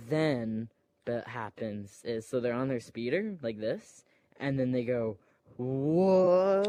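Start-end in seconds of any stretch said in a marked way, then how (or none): 5.6-6.6 high, loud startled whoa; hammed-up surprise; mock whoa. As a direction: yelp it like a rider nearly thrown. Retold speeder chase exclamation.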